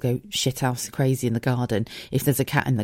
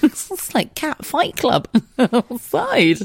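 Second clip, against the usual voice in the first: slow, high giggle over the words; laughing through it; 12 semitones higher, slower than usual. Real, not acted. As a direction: crack yourself up as you say it. Laughing at her own joke.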